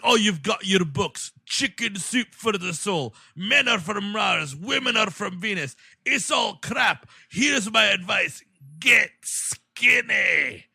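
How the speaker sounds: ranting TV host. Retold or imitated. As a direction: sneer and shout like a chauvinist pundit. Imitating the TV host.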